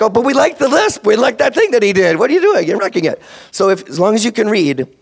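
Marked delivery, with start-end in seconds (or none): 0.0-3.2 high, whiny parent voice; mock upset parent. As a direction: complain like a fussy parent. Parents complaining about lost lisp.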